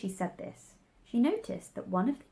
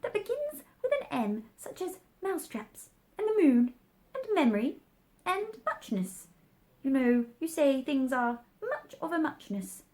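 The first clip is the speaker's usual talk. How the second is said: much higher, squeaky startled Dormouse; waking Dormouse; 6 semitones higher than usual. A narrator's reading. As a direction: squeak on, just jolted awake. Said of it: "woke up again with a little shriek".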